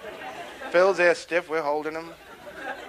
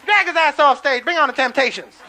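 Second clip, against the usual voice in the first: loud, high crowd shouts; hammed-up impatient audience; rowdy heckling; 10 semitones higher, 9 dB louder than usual. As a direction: yell to clear the stage.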